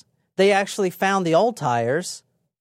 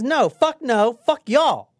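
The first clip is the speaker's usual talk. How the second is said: raised, defiant retort; mock defiance; 6 semitones higher, slower than usual. Suspect jokingly quoted telling off detectives, mock defiant. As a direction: throw out a higher-pitched mock refusal.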